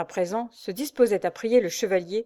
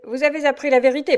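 higher and louder, losing his temper; raising his voice; 7 semitones higher, 7 dB louder than usual. A narrator's reading. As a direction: push the voice up in anger. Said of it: "en haussant encore le ton". (monter le ton, plus haut et plus fort)

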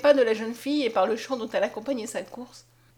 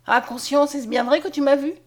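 higher, louder, eager; eager exclamation; 4 semitones higher, 6 dB louder than usual. A narrator's reading. Mariette in Conscience's arms, eager cry. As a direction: cry out hoping it is true. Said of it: "en s'écriant".

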